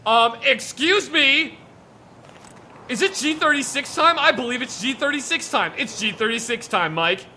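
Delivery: high, loud, playful build-up; mock-giddy announcement. Host announces G thirty-six time to Mike, mock-giddy.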